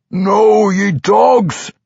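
loud, high enraged howl; raging dwarf yell; furious shout. A narrator's reading. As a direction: shout a curse at your captors. Trapped vampire cursing his captors.

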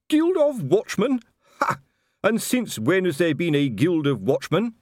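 mock the very idea; scornful outrage. A narrator's reading. Colon scoffing at the Guild of Watchmen, loud and outraged.

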